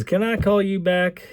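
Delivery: a touch of southern twang on a phone question; southern accent. Retold or imitated. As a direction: mimic a Texan asking politely, light southern. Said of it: "He's got a little bit of a southern accent".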